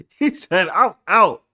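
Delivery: high, loud, laughing quote; laughing retell. Retold or imitated. Host laughing while quoting friend leaving. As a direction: crack up while quoting him.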